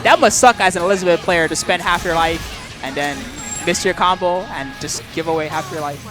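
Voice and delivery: ordinary delivery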